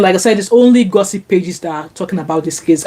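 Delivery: ordinary talk, nothing marked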